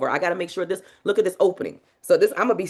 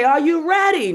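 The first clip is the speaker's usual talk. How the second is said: loud, high rallying shout; hype call; 7 semitones higher, 5 dB louder than usual. Shown as a demonstration. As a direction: fire the crowd up. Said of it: "And I'll say".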